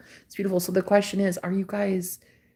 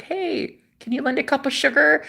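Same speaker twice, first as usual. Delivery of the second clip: higher-pitched neighborly request; friendly neighbor; 7 semitones higher than usual. Acted. Neighbor asking next door for sugar, friendly high voice. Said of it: "snuggled up next to our neighbors".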